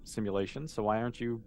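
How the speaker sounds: ordinary talk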